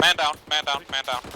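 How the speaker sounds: combat callout. Real, not acted. Casualty call in firefight.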